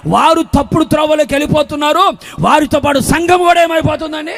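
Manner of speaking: driving, insistent sermon delivery; full-force preaching. Real, not acted. Preacher warning a church without rebukers, full-force preaching. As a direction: hammer out each phrase like a preacher.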